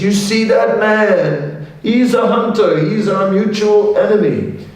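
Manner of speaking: a tiger plotting from below the tree; scheming tiger. Acted. Tiger tempting bear, acted voice.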